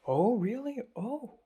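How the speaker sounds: high, mock surprised reaction; surprised oh really. Reacting to Miles mentioning Hong Kong friends, high mock surprise. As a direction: fake a little surprise, then deflate.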